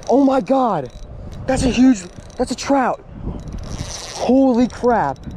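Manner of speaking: can't believe the size of it; real excitement. Real, not acted. Angler watching the trout near his lure, high awed cries.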